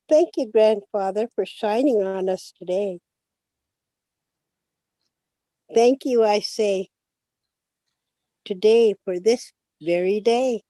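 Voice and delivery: gentle, unhurried prayer reading; calm English prayer with long pauses. Acted.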